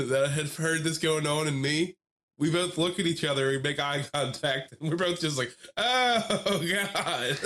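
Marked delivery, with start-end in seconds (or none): 5.8-7.4 high, drawn-out mock dismay; mock horrified reaction. Acting out two eavesdroppers' shared look, mock horrified. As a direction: wail it high, hamming up the shock.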